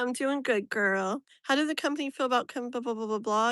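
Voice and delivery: usual speaking voice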